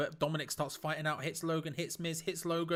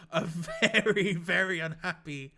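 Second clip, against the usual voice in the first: laughing; 6 dB louder than usual. Real, not acted. Laughing at crowd reaction.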